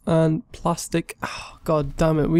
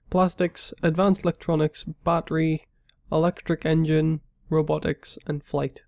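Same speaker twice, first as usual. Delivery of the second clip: ordinary delivery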